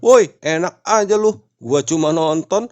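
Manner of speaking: high, loud furious outburst; angry yelling. A narrator's reading. Agus climbs down and yells at the couple, furious. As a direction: yell at the couple below, outraged. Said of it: "Agus turun dari pohon dan marah-marah".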